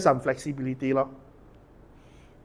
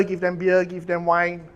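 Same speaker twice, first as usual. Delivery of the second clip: ordinary delivery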